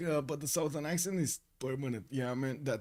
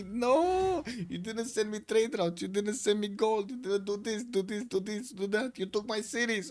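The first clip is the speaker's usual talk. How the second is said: mock complaint; 7 semitones higher than usual. Acting out a complaining player.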